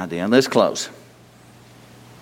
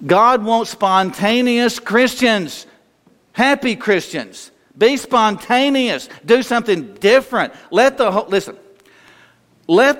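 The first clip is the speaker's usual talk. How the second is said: higher, slow emphatic sermon voice; fervent preaching; 6 semitones higher, slower than usual. Acted. Pastor urging congregation, slow and raised. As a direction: lift it and drive the point home slowly.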